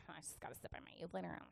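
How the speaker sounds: quick, low sulky mumble; imitated sullen teenage muttering; teen attitude. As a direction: grumble it under your breath. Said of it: "come in with an attitude".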